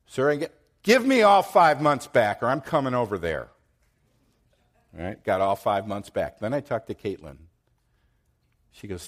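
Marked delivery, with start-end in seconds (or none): 0.1-3.5 loud, high angry ultimatum; furious ultimatum; retold threat yell. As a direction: demand it back, loud and threatening. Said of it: "I'm yelling at her".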